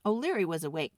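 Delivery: usual speaking voice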